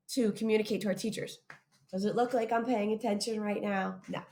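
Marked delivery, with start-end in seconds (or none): none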